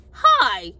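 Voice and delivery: loud, punched-out hi; abrupt, over-loud greeting; exactly how said. Retold or imitated. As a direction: greet loudly in one short burst. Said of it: "That's exactly how I said it too".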